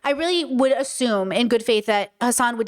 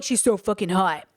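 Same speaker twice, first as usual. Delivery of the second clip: lower, lightly mocking leer; imitating Hassan; 5 semitones lower than usual. Quoting his remark about a woman, lightly mocking. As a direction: put on a low admiring voice, briefly. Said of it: "And Hassan going".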